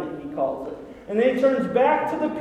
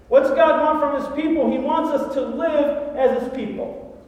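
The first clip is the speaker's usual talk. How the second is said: normal talking voice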